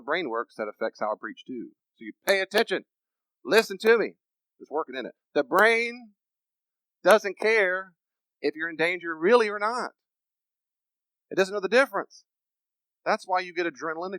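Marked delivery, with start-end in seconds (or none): 1.9-4.2 high, forceful preacher's command; emphatic. Shown as a demonstration. Preacher showing how preachers grab attention, emphatic. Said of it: "I'm being emphatic on purpose". 5.3-12.2 high, loud, spaced-out phrases; emphatic. Shown as a demonstration. Preacher telling how the brain treats danger, emphatic. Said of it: "I'm being emphatic on purpose".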